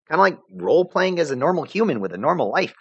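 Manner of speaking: put-on casual high girl voice; tossing it off lightly; breezy. Vriska's line, later recalled.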